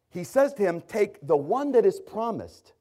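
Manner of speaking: high, loud pulpit voice voicing God; preaching at full force. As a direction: thunder God's command from the pulpit. Preaching builds again.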